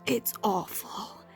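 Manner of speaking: normal talking voice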